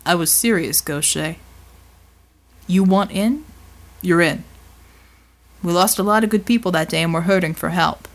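low-pitched, serious recruiting pitch; big man recruiting. Acted. Voices Bulwark recruiting Mel.

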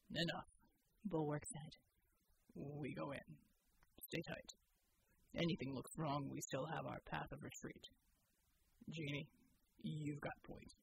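low, steady soldier voice; deep measured military man; Bull's voice. Acted. Soldier Bull reporting to the team, low and steady.

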